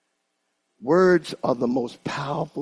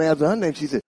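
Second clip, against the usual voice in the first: usual speaking voice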